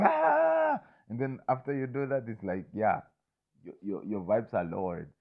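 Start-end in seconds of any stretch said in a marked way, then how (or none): 0.0-0.8 sudden high, loud shriek of alarm; mock startled scream. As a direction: jolt out a short scream like something just got you. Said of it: "So you go".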